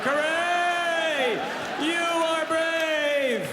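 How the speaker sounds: high, stretched cheer; applauding crowd yell. Retold or imitated. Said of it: "we would all be like".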